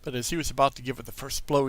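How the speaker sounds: plain reading voice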